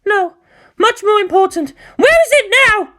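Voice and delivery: high, loud demand; urgent commander. Acted. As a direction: cut straight to the point.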